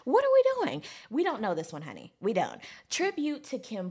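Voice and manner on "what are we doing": high loud disbelief; what is happening; incredulous. Real, not acted. Fan thrown by an unknown song, high incredulous cry. New song killed the vibe.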